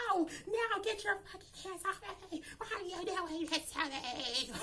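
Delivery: very high, soft wail, dragged out at the end; mock distress. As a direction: imitate a hysterical woman pleading. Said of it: "she was just going through it".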